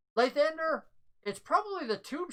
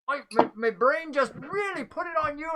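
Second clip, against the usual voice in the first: apologetic NPC; 7 semitones higher than usual. Acted. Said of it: "He goes".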